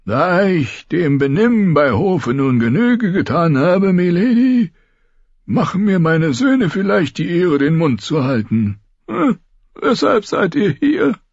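high, mocking old man; old lord mocking courtly manners; sarcastic old lord. A narrator's reading. Old lord addresses Caitlin sarcastically.

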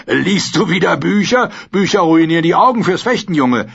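rough-and-ready mocking companion; amused sellsword Bronn. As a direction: tease the boy, then call out what you see.

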